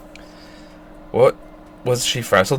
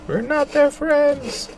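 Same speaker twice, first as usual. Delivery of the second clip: slow, much higher squeaky declaration; high character voice; much slower than usual. Acted. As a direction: stretch the words out very high and slow. Same line voiced again differently.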